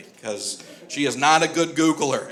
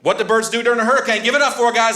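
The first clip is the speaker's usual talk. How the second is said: hyped-up; 5 semitones higher, 5 dB louder, faster than usual. Acted. Hyping the crowd's search phrase.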